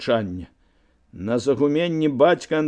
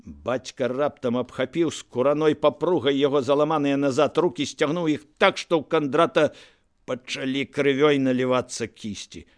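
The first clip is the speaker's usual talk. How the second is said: plain reading voice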